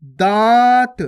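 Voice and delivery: दांत with a long nasal vowel, no n consonant; nasalised vowel. Shown as a demonstration. Teacher modelling chandrabindu, loud long nasalised दांत. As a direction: draw out दांत through nose and mouth. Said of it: "चंद्र बिंदु की मात्रा".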